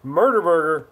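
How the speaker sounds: usual speaking voice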